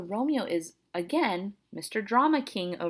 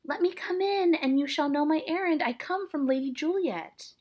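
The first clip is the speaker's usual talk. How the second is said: high-pitched acted nurse voice; nurse calling from outside; 9 semitones higher than usual. Nurse asking the friar to let her in, calling high. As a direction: call in through a closed door. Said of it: "from within".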